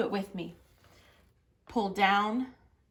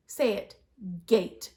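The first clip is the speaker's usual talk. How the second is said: ordinary talk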